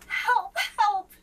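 high, loud panicked yelling; frantic cry for help. A narrator's reading. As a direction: shout for help in alarm. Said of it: "cried the page".

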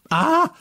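a high, loud shriek of terror; frightened scream. A narrator's reading. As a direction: scream at the ghost in the doorway. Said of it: "schrie ich wieder".

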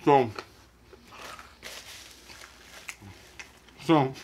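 chewing while talking; muffled closed-lip speech; mouth full. Real, not acted. Seen 5: Lips closed and working, cheeks full; just bit food, wipes mouth.

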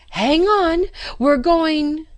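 high, loud warning cry; warning shout. A narrator's reading. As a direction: yell to him over the rushing beast. Warning shout while clinging on.

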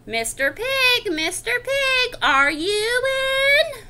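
drawn-out, high singsong call. Acted. Wolf at the stick house, drawn-out calling out. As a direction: call slowly through a closed door. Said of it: "I called".

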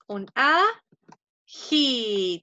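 "ají" drawn out very slowly, the last syllable "jí" leaned on; stress on the i. Shown as a demonstration. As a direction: stretch out "ají" and land hard on the final í. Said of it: "Tiene un acento en la I". (acento marcado en la "í" de "ají", muy despacio)

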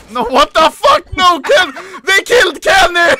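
high, loud outraged yell; outraged shout. Real, not acted. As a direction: scream at the kill. Teammate killed, outrage.

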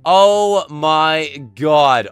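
loud, drawn-out cry of dismay; shocked outburst. Real, not acted. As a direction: exclaim slowly and loudly in disbelief. Loses the run in game.

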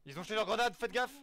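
loud, high urgent yell; grenade warning yell. Real, not acted. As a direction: shout the warning to the squad. Enemy grenades thrown, warning squad.

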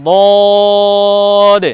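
বদ as heavy Arabic daad, vowel held very long; tongue-side Arabic daad. Shown as a demonstration. Teacher demonstrates a difficult letter, long heavy বদ. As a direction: press tongue side to upper molars and stretch বদ. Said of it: "ওই পাঁচটি দাঁতের গোড়াতে লাগিয়ে".

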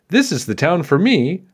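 tiny knight. A narrator's reading. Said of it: "said the tiny knight".

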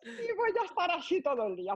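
high, laughing mock despair; retelling her dismay on rugby day. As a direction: wail it through a laugh. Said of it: "Y yo".

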